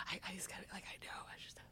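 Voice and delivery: hushed unvoiced whisper in the dark; whispering to Val. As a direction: whisper it so only your friend hears. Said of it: "I'm, like, whispering to Val".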